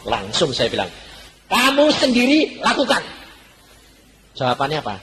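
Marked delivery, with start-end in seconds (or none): 1.5-3.0 stern command. Retold or imitated. Pastor ordering couple to act.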